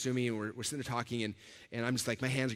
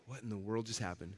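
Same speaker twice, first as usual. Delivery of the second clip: soft, lower, dazed question to himself; stunned murmur; 4 semitones lower, 9 dB quieter than usual. Retold or imitated. Speaker alone in the wind after meeting Tony, quietly stunned. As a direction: let it out under your breath in disbelief.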